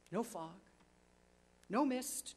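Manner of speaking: plain reading voice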